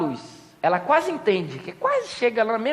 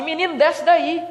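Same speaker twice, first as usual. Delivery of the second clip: put-on higher, sharp mother calling to the child; scolding call; 7 semitones higher, 3 dB louder, slower than usual. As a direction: yell up at the kid mid phone call. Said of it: "fala assim".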